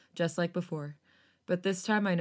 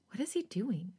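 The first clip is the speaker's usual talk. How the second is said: whispering; 8 dB quieter than usual. A narrator's reading. Whispered inner thought.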